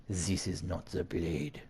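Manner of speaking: put-on slow French-accented villain. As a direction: spell it out slowly with a French accent. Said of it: "you want the French accent".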